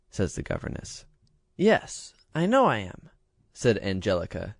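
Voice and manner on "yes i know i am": put-on high, smug little girl; a spoiled child who knows she's adored; vain princess. A narrator's reading.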